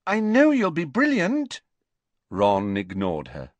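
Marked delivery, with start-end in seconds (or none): 0.1-1.6 encouraging. A narrator's reading. Lavender encouraging Ron.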